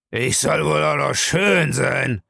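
slow, surly man's grumble; brusque and drawn-out villain voice; gruff. A narrator's reading. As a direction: grumble back gruffly, drawing it out. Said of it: "sagte er unwirsch".

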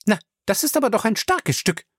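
high-pitched indignant outburst; indignant, voice jumping up; snapping. A narrator's reading. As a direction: snap at him, affronted. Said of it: "fuhr sie ihn an".

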